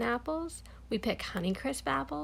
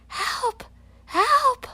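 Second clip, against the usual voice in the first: high, loud cries for help; boy stuck on a branch, yelling for help; 8 dB louder than usual. Acted. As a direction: shout it out from the branch, rising in panic. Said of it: "Lionel yells".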